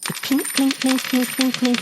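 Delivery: high voiced clink of coins; clinking sound. Narrator voicing coins falling, ringing clinks. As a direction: ring out each clink like a coin.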